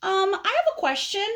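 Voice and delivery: high, put-on little caterpillar voice; pestering caterpillar. Caterpillar interrupts his friend with a question, pestering. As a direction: pipe up high with a request. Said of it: "And so this guy says".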